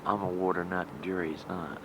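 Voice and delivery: ordinary talk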